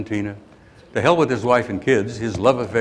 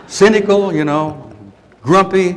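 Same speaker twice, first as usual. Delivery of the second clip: loud, higher mock grumpiness; mock grumpy; 7 semitones higher, 8 dB louder than usual. Real, not acted. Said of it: "getting kind of grumpy".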